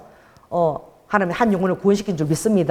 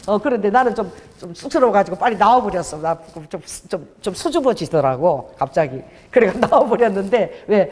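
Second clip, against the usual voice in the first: ordinary delivery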